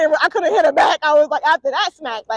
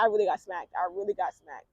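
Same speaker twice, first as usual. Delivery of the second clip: quiet repeat; 6 semitones lower, 8 dB quieter than usual. Real, not acted. Repeated, fading.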